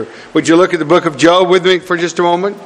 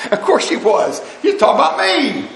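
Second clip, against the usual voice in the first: laughing, then rising very high; high-pitched, incredulous punchline; amused self-mockery; 11 semitones higher than usual. Real, not acted. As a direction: laugh at your own naivety. Laughs at his own naive assumption.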